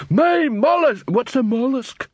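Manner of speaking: high, slow confused troll; puzzled troll. A narrator's reading. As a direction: ask it like a baffled troll.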